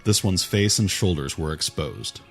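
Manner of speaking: ordinary delivery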